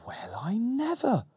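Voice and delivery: slow, high surprised gasp. Acted. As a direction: gasp it out in shock. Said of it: "gasped the shadow".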